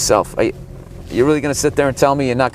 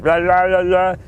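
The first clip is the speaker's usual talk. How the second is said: mock Austrian gibberish; 7 semitones higher than usual. Mocking Schwarzenegger's accent.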